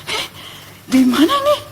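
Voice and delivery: high, mildly startled confusion. Retold or imitated. Waking at a roadside stall, acted startled. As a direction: wake up bewildered, pitched high. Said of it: "Saya langsung kaget".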